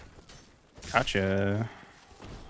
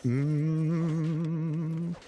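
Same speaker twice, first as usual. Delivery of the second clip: long, high wavering hum; wind-up hum; 6 semitones higher than usual. Pretend. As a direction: hum high and hold it before the strike. Wind-up hum before attack.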